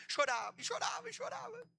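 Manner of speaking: high-pitched imitation of sobbing; hammed-up crying; mock sobbing. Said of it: "o diabo chorava de soluçar".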